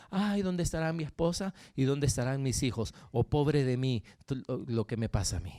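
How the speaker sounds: low, self-pitying lament; mock tearful lament. Preacher imitating a man crying over wife and children, low mock lament. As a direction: imitate someone wallowing in sorrow, low and subdued. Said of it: "llorando, diciendo".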